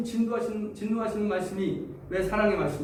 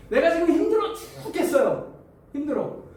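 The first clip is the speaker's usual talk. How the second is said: high-pitched, worn-out griping; exasperated complaint; 8 semitones higher, 3 dB louder than usual. Acted. Struggling believer complaining to God, exasperated. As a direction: moan that you are at your limit.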